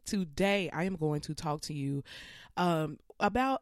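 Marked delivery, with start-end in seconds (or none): none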